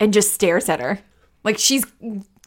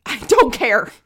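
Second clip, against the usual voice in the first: angry, higher-pitched outburst; Marin; 6 semitones higher, 4 dB louder than usual. Acted. Marin finally gets it and snaps, really angry. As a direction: burst out crankily, pitched up. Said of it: "and then she gets really angry".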